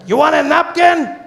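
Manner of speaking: very high, loud, slow shout; a high, drawn-out yell from the truck; taco guy shouting. Retold or imitated. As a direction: yell it at him like a pushy vendor. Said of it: "He's like".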